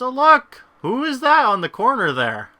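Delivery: sudden high excited gasp of discovery; loud, delighted surprise; surprised. Real, not acted. Spotting new character on screen.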